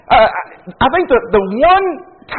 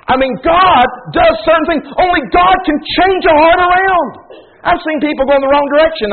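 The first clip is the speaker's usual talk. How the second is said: higher, shouted pulpit cry; preaching shout; 8 semitones higher than usual. Acted. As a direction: proclaim it to the back row. Preaching at full force.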